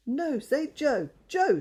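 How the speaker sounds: slow, loud and high; a boy loudly teaching the budgie his name. A narrator's reading. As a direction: boom the name so the bird hears. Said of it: "in such a loud voice".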